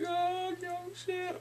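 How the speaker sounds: high, drawn-out wail of a name; mock crying. Bong Su seeing Kyung Sung, mock tearful cry. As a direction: imitate a tearful wail, slowly. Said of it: "starts crying and everything".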